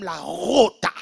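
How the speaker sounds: "rota" with a heavy scraped R; rolled throaty R. Retold or imitated. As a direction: exaggerate the R in "rota", loud and drawn out. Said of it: "elle va faire les R comme".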